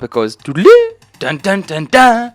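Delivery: sung game intro jingle, loud and high; imitating game jingle. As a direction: hum the game's opening tune. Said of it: "when you open it up".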